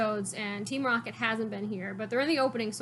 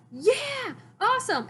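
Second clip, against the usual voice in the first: much higher excited cheer; 11 semitones higher, 4 dB louder, slower than usual. Retold or imitated. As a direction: squeal it like thrilled kids. Ash and Go cheering.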